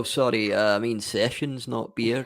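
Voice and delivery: ordinary talk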